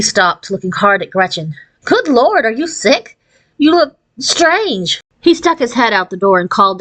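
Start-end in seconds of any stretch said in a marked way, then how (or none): 1.8-5.0 higher, startled, slower at the end; shocked at how his daughter looks; mild mock alarm; alarmed father.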